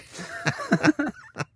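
high burst of laughter; hearty laugh. Real, not acted. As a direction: laugh out loud at the memory. Laughs at end of his story.

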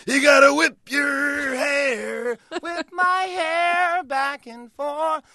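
high, loud, slow sung shout; high stretched rock wail; high-energy belt. Retold or imitated. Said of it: "jump in with that energy".

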